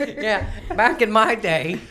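put-on high, loud, slow old codger; very old man. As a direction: croak it like a cranky grandpa. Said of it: "old as fuck".

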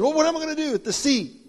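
high, fast, disbelieving protest; incredulous Moses. Acted. Moses protesting to the Lord about a stick, high and fast. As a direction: squeak out the doubt, quickly.

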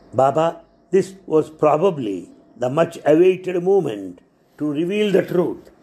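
ordinary delivery

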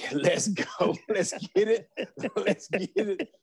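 playfully reenacted childhood excitement; excited kid. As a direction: laugh through a gleeful rallying cry. Excited kid wanting cookies and juice.

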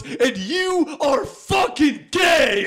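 very high, loud, slow final insult; parting shout. Retold or imitated. Kid hurling a final insult at the host, shouting. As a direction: fling the last insult and run. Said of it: "looks at Dan Galway and goes".

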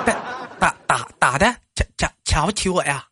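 light stammering bumpkin voice; mild stutter impression. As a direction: stammer indignantly. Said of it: "我给你学几个人".

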